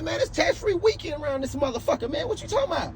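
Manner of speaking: arguing back hard at the clerk, voice way up; heated argument. Retold or imitated. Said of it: "damn near shouting match".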